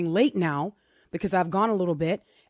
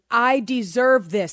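"I deserve this" slow, louder and higher; emphatic self-blame; 4 semitones higher, 6 dB louder, slower than usual. Retold or imitated. Driver admitting fault as she pulls in, emphatic. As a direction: lean hard on "I deserve this", drawing it out.

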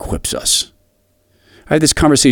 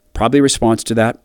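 usual speaking voice

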